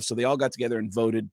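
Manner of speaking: usual speaking voice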